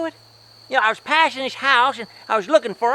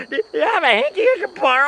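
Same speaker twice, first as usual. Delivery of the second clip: ordinary delivery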